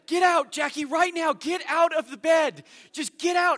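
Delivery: high, loud panicked yelling; husband yelling at his wife about a spider, loud and high; retold yell. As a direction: shout her out of bed. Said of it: "began to yell at my wife".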